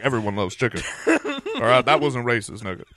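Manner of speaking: normal talking voice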